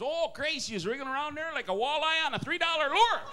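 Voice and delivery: excited mock Wisconsin lover; Wisconsin accent. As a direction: holler it high in a broad Wisconsin accent. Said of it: "with a Wisconsin accent".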